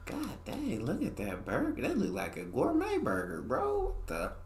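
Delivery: high-pitched shocked wonder; amazed disbelief. Real, not acted. Shocked at gourmet-looking burger.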